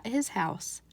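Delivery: ordinary delivery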